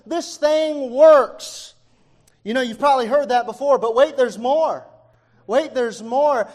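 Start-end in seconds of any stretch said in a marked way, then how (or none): 0.1-1.8 loud, high, drawn-out product boast; excited pitchman. Retold or imitated. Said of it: "He's excited. He's saying". 3.9-6.5 loud, slowed TV sales hook; infomercial catchphrase. Retold or imitated. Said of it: "All these infomercials".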